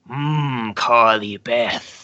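slow, low drawn-out repeat of the name; lightly voiced slow musing shopkeeper; mock shopkeeper musing. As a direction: savor the name slowly like a creepy shopkeeper. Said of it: "he's like".

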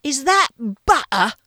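really bad English accent, high and slow; incredulous chef with a fake English accent; Gordon Ramsay impression. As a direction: interrogate the cook in a hammed-up English accent. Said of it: "a really bad English accent".

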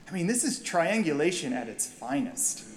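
ordinary talk

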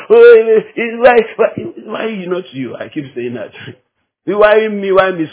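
ordinary talk

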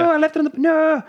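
very high mock wail; dismayed Willie. Willie left it on the bus, high mock dismay. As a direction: imitate a villain's comic cry of loss.

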